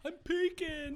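high, loud distorted imitation; exaggerated loud squawk; mock peaking. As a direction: yelp it high and loud, mocking an overdriven mic. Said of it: "he's peeking. He was, he was".

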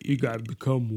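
low, soft mumbling action hero; deep Rambo voice, kept quiet; Stallone. Acted. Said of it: "You wanna do your Stallone?".

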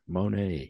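"Moné" stretched, ending on a long "ay"; drawn-out name. Shown as a demonstration. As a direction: linger on both syllables of "Moné". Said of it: "only way to say it".

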